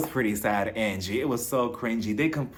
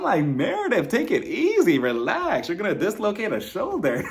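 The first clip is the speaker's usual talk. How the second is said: mock alarm; 6 semitones higher than usual. Telling overzealous Meredith to calm down.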